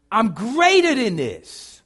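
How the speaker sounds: loud, slow and falling; declaration. Acted. Preacher echoing the heart's cry to the church, loud. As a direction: land greater like a vow.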